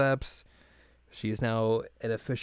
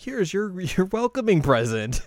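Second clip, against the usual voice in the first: gleeful presenting; 8 semitones higher, slower than usual. Retold or imitated. Teacher presenting cat ears.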